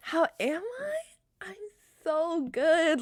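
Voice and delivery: high, slow and wondering; delighted surprise. Retold or imitated. To the kind clerk, a slow, amazed and delighted answer. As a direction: savour the surprise of being asked.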